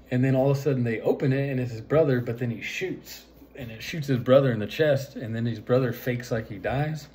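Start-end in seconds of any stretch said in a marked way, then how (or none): none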